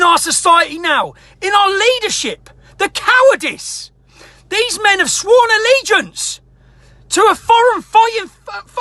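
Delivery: raging, near-shouting, pitch way up; furious rant. Real, not acted. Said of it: "I know I'm gonna pop a blood vessel here".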